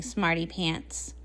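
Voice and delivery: ordinary talk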